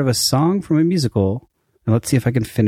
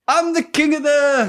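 hammed-up movie line; 12 semitones higher than usual. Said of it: "doing a thing with my arms".

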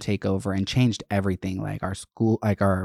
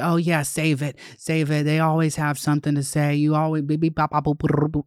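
higher, dismissive mock principal; the principal dismissing me, mocked in a higher voice; 5 semitones higher than usual. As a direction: brush her off like a smug principal, pitched up. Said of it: "And he's like".

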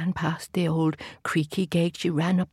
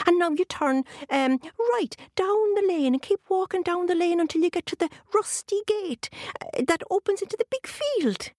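leprechaun; 11 semitones higher than usual. A narrator's reading. Leprechaun gives directions.

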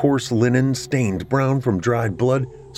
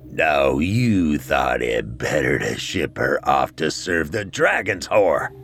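low, slow reproachful dead father; a deep, slow reproachful ghost; ghostly father voice; 5 semitones lower, slower than usual. A narrator's reading.

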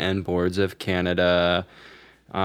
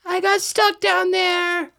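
high put-on voice; slower than usual.

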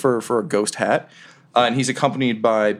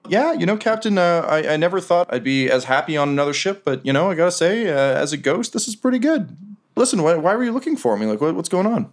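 higher-pitched, earnest sailor voice; contented ghost crewman; 7 semitones higher than usual. Acted. Boots telling the old captain about afterlife, contented.

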